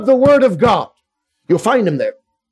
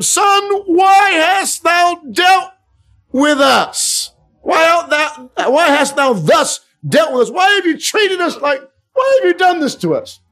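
distressed mother; 8 semitones higher, slower than usual. Acted. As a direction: cry out like a frantic mother. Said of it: "And his mother said unto him".

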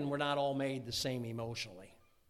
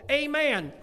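loud, high "Amen"; hearty amen; 10 semitones higher, 10 dB louder than usual. Shown as a demonstration. Urging wives to just say amen, hearty and loud. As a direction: belt out a wholehearted amen.

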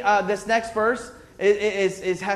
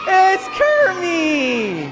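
excited greeting; 9 semitones higher, 5 dB louder, much slower than usual. Real, not acted. Host greets puppet.

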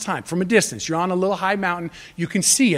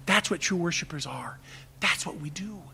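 hushed whisper after the shout; 2 semitones lower, 11 dB quieter than usual. Real, not acted. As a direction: drop to a whisper to draw them in. Sudden drop after loud prayer.

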